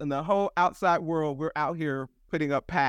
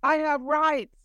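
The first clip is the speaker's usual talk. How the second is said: put-on a small kid insisting on his rights, pitched up high; little boy protesting; 11 semitones higher than usual. To the substitute teacher, a little boy insists on his rights in a high, indignant voice. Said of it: "and he was like".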